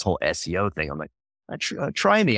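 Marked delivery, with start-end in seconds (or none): 1.5-2.4 high-pitched, halting "try me" challenge; retold challenge. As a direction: dare them to explain, voice pitched up. Retold challenge to sales jargon.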